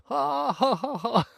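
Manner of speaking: high giddy ha ha; drunken laughter. Retold or imitated. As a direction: laugh like you're blissfully drunk. Retold drunk laughter again.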